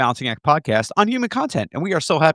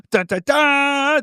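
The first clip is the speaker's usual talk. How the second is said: high imitated sting, last note higher; dramatic dun-dun-dun; 13 semitones higher, much slower than usual. Shown as a demonstration. Host shows off the Mac's little effect, dramatic imitated sting. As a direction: sing out a suspense sting. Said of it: "I can do this".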